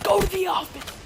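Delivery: high-pitched mock teacher scolding; imitated teacher's order. Teacher sending a kid to the office, high mock scolding.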